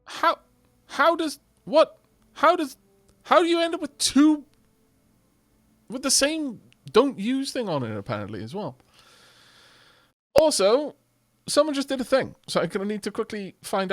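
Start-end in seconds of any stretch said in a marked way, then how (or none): 0.0-8.8 baffled. Real, not acted. Baffled by duplicate items.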